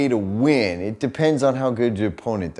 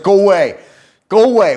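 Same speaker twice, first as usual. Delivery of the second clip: high, louder mock "go away" twice; mock shout; 9 semitones higher, 9 dB louder than usual. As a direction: shoo the kid off, hammed-up. Shooing the bowling-alley girl.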